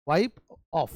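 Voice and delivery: usual speaking voice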